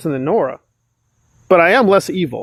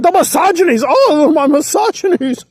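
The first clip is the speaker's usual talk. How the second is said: high, mocking wail of the misogynies; 8 semitones higher than usual. Mocking Nora to his viewers, a high hammed-up wail. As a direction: ham up her cry of victimhood, pitched up.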